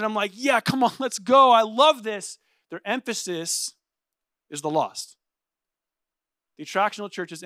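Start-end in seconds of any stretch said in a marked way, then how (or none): 0.3-2.4 higher, pumped-up cheer; enthusiastic agreement. Acted. Pastor cheering on the eager host team, pumped up. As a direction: egg them on excitedly.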